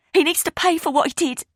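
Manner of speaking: high, loud furious cry; shouting over music. Acted. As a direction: make herself heard over the band.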